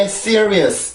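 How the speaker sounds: "serious" as English, strong s, three syllables; English pronunciation. Shown as a demonstration. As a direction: say "serious" compactly with English stress up front. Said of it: "이렇게 들리는 게 아니라". (영어 발음으로 "serious")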